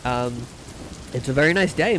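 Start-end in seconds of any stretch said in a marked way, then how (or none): none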